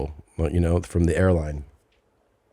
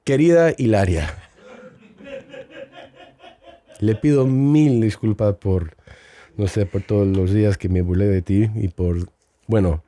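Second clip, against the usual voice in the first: formal, lightly theatrical apology in Spanish; mock apology in Spanish. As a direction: address Hilaria like a heartfelt public apology. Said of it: "fun watching you talk Mexican".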